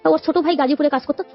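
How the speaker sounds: normal talking voice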